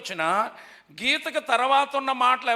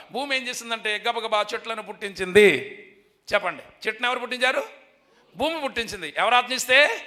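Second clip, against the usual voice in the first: ordinary delivery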